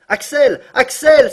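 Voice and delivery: a high, loud joyful shout; crying out. Acted. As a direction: call out his name in wild delight. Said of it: "s'écria-t-il". (crier à pleine voix, fou de joie)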